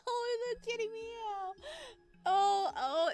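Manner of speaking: high, slow squeal at a cute cat; delighted squeal. Real, not acted. Gamer squealing at a cute kitty, delighted. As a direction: coo over the kitty in delight.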